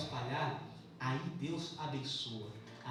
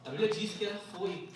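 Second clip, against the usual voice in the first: raised, louder church preaching voice; urgent, higher-pitched sermon delivery; forceful preaching; 4 semitones higher, 8 dB louder than usual. Real, not acted. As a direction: preach it out to the congregation.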